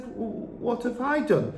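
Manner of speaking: put-on high, soft stammering girl; timid Matilda. A narrator's reading. Matilda frightened, answering the headmistress.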